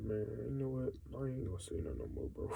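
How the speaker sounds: giving up. Retold or imitated. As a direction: mutter it fast, done with everyone. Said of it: "I'm just like".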